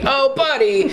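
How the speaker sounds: high, chirpy ventriloquist voice; squeaky ventriloquist voice. Retold or imitated. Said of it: "Where he's, like, a ventriloquist dummy".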